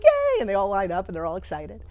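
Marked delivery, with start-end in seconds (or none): 0.0-0.4 put-on high, loud children's yay; squealed yay, much higher and louder; kids cheering. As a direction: cheer like a crowd of happy kids. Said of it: "all the kids are like".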